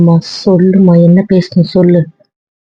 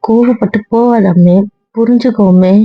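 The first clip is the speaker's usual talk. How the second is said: pleading; 4 semitones higher than usual. Acted. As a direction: plead with them not to leave angry. Begging wife not to leave.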